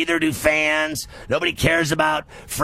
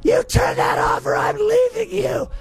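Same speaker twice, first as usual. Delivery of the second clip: much higher falsetto wife voice, shouted; mock nagging wife. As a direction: threaten to leave in a high mock wife voice. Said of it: "make your wife leave you".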